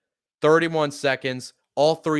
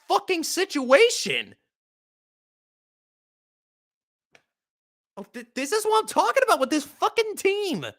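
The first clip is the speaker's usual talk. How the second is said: much higher, ranting at the coaches; exasperated rant; 13 semitones higher than usual. Real, not acted. Fan ranting at the Chargers over a draw play, exasperated. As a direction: vent at the bad call in a high, strained voice. Raging at team's play call.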